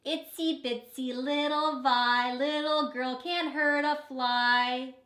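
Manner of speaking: mock her like bratty big brothers; teasing brothers. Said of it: "her brothers teased her and called her names".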